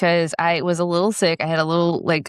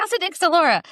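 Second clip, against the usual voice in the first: mock cheerful; 12 semitones higher than usual. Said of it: "I was like".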